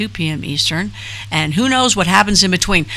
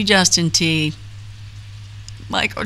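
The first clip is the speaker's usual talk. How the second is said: ordinary talk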